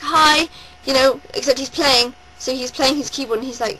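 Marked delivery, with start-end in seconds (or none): 0.0-0.5 higher, louder cheery hi; "Hi" said high and loud like a friendly wave; bright greeting. Retold or imitated. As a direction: call out a happy "Hi" across the room. Singer greeting from the stage.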